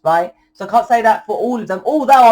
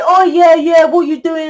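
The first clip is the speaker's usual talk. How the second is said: much higher and louder, gushing and sugary; bubbly chirpy voice; 7 semitones higher, 7 dB louder than usual. Pretend. Mocking the sweet voice people expect from her, high and gushing. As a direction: put on an overly sweet, perky greeting. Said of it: "I'm not gonna talk like that".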